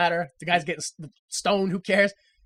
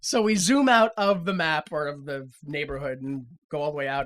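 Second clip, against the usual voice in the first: normal talking voice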